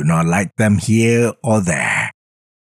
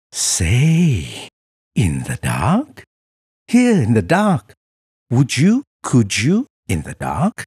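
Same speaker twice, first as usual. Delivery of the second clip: higher, playful coaxing voice; Sam-I-Am voice; 6 semitones higher than usual. Acted. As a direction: tempt him into the dark. Sam offering in dark.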